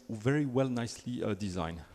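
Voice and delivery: usual speaking voice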